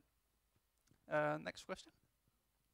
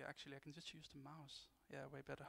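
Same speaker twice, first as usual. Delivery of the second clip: normal talking voice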